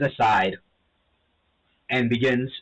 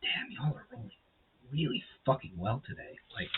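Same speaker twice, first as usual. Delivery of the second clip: whispering; 2 semitones higher, 12 dB quieter than usual. Real, not acted. Whispered aside to players.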